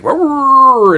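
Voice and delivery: high, loud roaring cry; monster roar. Retold or imitated. Leatherface sliding the door open, loud roar. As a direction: roar like a crazed killer. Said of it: "he's like".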